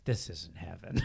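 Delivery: flat and low, deflated realization; low muttered letdown; deadpan disappointment. Retold or imitated. Tripping friend muttering at Vegas, quietly deflated.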